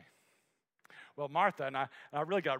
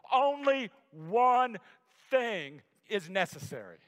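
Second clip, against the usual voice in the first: "only one" stretched out loud and high, then falling; slow emphasis; 4 semitones higher, 7 dB louder, slower than usual. Shown as a demonstration. Preacher drives home the verse to everyone, slow and loud. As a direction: drag out "only one" for the whole congregation. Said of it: "I wanna rush over that".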